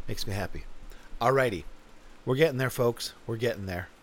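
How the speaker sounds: usual speaking voice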